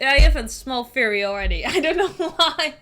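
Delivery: ordinary delivery, nothing marked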